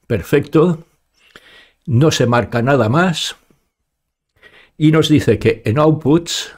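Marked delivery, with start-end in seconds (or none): none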